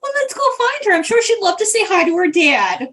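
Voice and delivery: high-pitched, eager gushing that eases down; gushing busybody. Acted. Greta leads the way to Bronwyn, gushing. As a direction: start very high and bustle them along.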